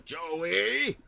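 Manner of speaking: loud, high drawn-out name call; playful high holler; mock call. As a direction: holler the name as a running gag. Calling Joey's name as running bit.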